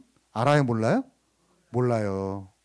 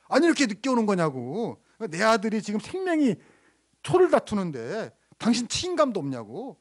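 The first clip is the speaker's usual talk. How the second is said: higher-pitched mock outburst, not really shouted; retold angry father; 6 semitones higher than usual. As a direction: act out an indignant father's protest. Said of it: "고래고래 소리를 칩니다".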